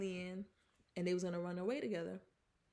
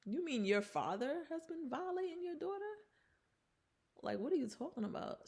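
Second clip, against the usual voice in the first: higher, incredulous retort; indignant disbelief; 8 semitones higher than usual. Real, not acted. Viewer talking back to the mother, incredulous and higher. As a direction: scoff at the accusation, pitched up.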